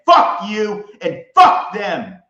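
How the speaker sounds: full-volume defiance; furious shout. Real, not acted. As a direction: bellow it slowly word by word. Cursing, then repeated quieter.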